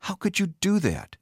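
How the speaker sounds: very high, hurt outcry; betrayed, high-pitched reproach; anguished accusation. A narrator's reading. Betrayed wife accuses husband.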